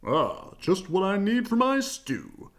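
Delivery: hearty, pleased bear father; a touch of cheer as Papa Bear; Papa Bear delighted with his rusty water; cheerful Papa Bear. Acted. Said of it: "he said cheerfully".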